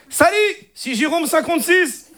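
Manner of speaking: put-on high-pitched Moroccan-accented French; Moroccan Arabic accent. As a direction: cousin with a thick Moroccan accent, posing as French. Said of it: "l'accent arabe".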